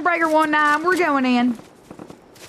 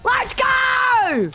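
high, drawn-out victory shout; triumphant yell; 8 semitones higher than usual. Real, not acted. Player celebrating a kill, triumphant yell. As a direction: yell it high and stretch the last word.

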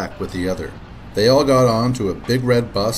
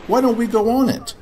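put-on much higher child voice; little girl voice; 11 semitones higher than usual. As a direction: voice a small girl. Katy voiced.

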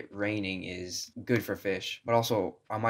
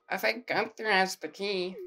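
a hint of a higher put-on voice; lightly voiced character; 9 semitones higher than usual. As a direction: read her line in a higher voice. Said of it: "I already forgot her voice".

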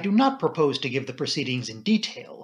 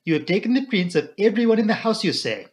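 put-on higher, French-accented probing; Poirot; 7 semitones higher, 4 dB louder than usual. A narrator's reading. Poirot checks with the inspector, French accent. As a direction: query it in a foreign accent. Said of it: "murmured Poirot".

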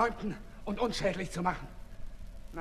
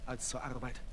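quiet weary; 6 semitones lower, 9 dB quieter than usual. Acted. Weary sick man answers.

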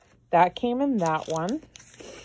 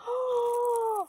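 high, long squeaky gasp; sharp intake of delighted shock; high-pitched gasp of amazement; amazed gasp. Real, not acted. Amazed by gift.

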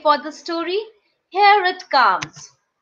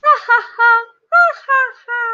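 high, loud storybook laugh; acted laugh; 7 semitones higher, 6 dB louder, much faster than usual. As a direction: laugh out in separate, high ha-has. Story characters laugh at the end.

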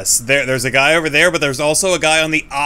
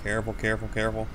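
quiet; 3 semitones lower, 10 dB quieter, faster than usual. Real, not acted. Sneaking up on enemies.

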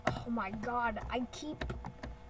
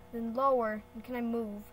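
usual speaking voice

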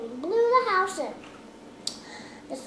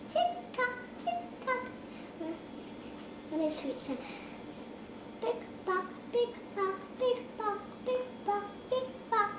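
high hoof clip-clop; 6 semitones higher, 6 dB quieter than usual. Acted. Middle goat's footsteps.

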